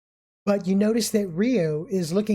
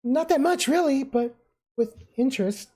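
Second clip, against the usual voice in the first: much higher, sheepish shrug of a voice; Rio downplaying; 7 semitones higher than usual. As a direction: play it down, embarrassed.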